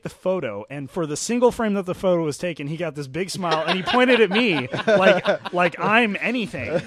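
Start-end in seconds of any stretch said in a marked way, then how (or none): 3.7-6.8 laughing retelling. Retold or imitated. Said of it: "like that".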